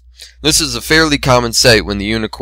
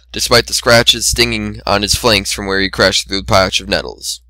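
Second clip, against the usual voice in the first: plain reading voice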